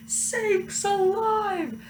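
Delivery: high, slow alarmed shout; farmer shouting. A narrator's reading. Farmer shouting after the cows, high and slow. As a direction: farmer hollers after his runaway truck. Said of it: "The farmer shouted".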